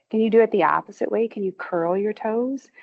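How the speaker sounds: ordinary talk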